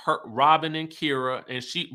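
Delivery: normal talking voice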